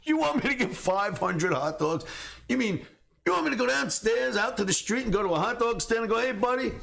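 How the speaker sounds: can't believe the boss asks this; incredulous. Retold or imitated. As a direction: kid questioning the boss's order in disbelief. Kid incredulous at order.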